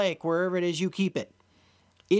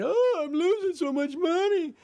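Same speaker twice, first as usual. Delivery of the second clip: high, whining mock complaint; sarcastic high whine of a panicked trader; whiny loser traders; 8 semitones higher than usual. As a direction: mimic a moaning trader, pitched high. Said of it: "these people that come on my side".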